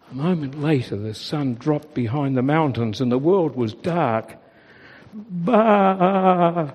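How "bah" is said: alarmed bleat. A narrator's reading. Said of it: "Daisy bleated in alarm".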